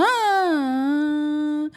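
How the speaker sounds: put-on loud baby wail that starts strong and falls away; imitating a German newborn crying with a falling melody. Shown as a demonstration. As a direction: blast a baby's cry then let it drop. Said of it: "los alemanes eran como".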